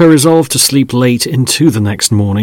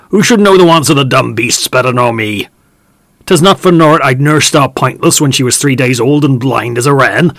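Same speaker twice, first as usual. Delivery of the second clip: put-on raised, indignant rustic old man; old farmhand; 4 semitones higher, 6 dB louder than usual. Old farmhand protesting to Mus Reuben, raised and indignant. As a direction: plead hotly with the farmer in broad country speech.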